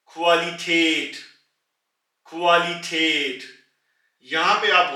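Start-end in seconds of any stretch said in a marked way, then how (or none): 0.1-1.2 क्वालिटेट drawn out slowly; slow model word. Shown as a demonstration. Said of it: "पहले कू बोला और फिर उसके बाद आ". 2.3-3.4 क्वालिटेट drawn out slowly; slow model word. Shown as a demonstration. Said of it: "पहले कू बोला और फिर उसके बाद आ".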